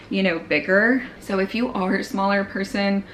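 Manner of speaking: usual speaking voice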